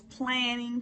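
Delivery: "planning" drawn out slow and high; stress on planning. Shown as a demonstration. Speaker stressing the second P, slow "planning". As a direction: stretch "planning" out, slow and lifted. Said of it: "repeated for emphasis".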